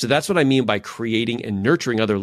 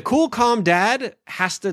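slow and amused; 10 semitones higher, much slower than usual. Real, not acted. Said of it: "let me just say".

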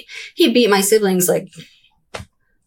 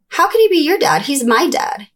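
put-on higher, indignant kid voice; imitating her child self; 8 semitones higher than usual. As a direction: protest like a confused little girl. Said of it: "I remember being like".

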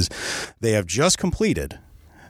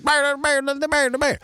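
high-pitched unintelligible hillbilly babble; Boomhauer, but worse; slower than usual. Retold or imitated. Storyteller mimicking Skeeter nobody can understand, Boomhauer-style babble. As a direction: jabber nonsense like a mush-mouthed hillbilly. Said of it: "probably like Boomhauer from King of the Hill".

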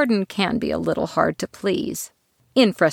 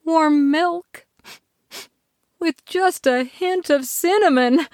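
dreamy; 10 semitones higher than usual. A narrator's reading. Dreamy savouring of smell.

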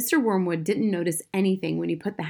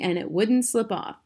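ordinary delivery

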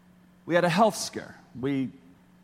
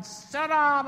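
mock yell; 11 semitones higher than usual. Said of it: "lady's yelling".

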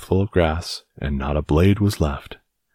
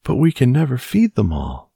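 put-on worried old woman; 9 semitones higher, 4 dB louder than usual. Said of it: "said the very old woman".

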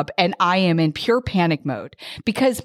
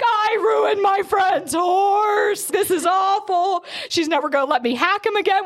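mock crying wail; 11 semitones higher than usual. Rider crying in tack room over friend's horse, mock wail. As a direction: ham up a tearful wail, much higher. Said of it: "crying in the tack room".

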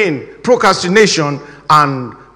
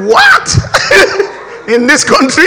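high, loud, baffled outburst through laughter; mock disbelief, pitched up; incredulous train man; 8 semitones higher, 4 dB louder than usual. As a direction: act a flabbergasted ticket clerk, laughing. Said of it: "The train man said".

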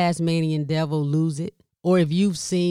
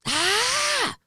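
much higher exasperated "ah!"; exasperated cry. Retold or imitated. Caregiver interrupted while frying oysters, fed-up cry.

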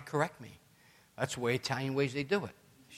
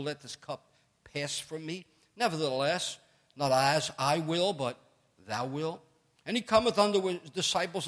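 raised, measured King James reading voice; scripture reading; 4 semitones higher than usual. Acted. Preacher reading Gethsemane to the congregation, solemn scripture reading. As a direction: read the Gospel with weight and rising feeling.

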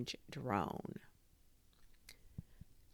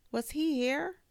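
surprised; 12 semitones higher, 5 dB louder than usual. A narrator's reading. Narrator wonders.

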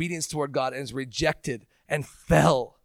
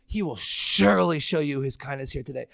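ordinary delivery

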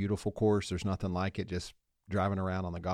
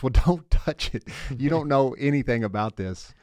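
higher, louder, irritated retort; annoyed injured man; 4 semitones higher, 6 dB louder than usual. Retold or imitated. Struck golfer tells the man checking his knee off, annoyed and louder. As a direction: push the helper off with an annoyed, louder voice.

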